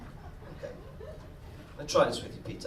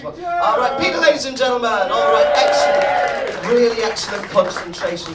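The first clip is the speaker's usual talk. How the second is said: loud, high stage announcer; showman shout; 6 semitones higher, 11 dB louder than usual. Acted. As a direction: whip up the crowd's applause. Applause for volunteer.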